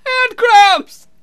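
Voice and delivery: hammed-up cramp scream, very high; pained shriek. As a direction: shriek it out in comic agony. Vampire hands cramping.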